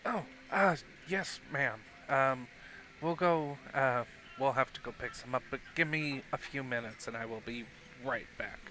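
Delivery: soft, hesitant servant voice; timid, hushed reply; flustered maid. Acted. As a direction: answer quietly and deferentially, with small hesitations. Maid answering a guest's request.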